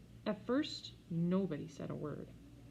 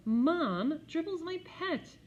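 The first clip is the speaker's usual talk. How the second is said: indignant protest; 11 semitones higher, 5 dB louder, slower than usual. A narrator's reading. Peter protests.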